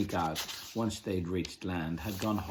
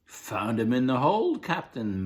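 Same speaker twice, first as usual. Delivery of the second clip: higher, louder rough deckhand; a hint of a gruff pirate crew member; pirate crew member reporting to the captain; lightly voiced pirate; 5 semitones higher, 7 dB louder than usual. A narrator's reading.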